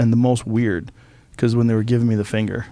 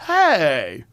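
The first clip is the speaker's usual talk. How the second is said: higher, drawn-out mock objection; retold offended protest; 5 semitones higher than usual. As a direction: protest at being teased. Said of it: "my initial response was".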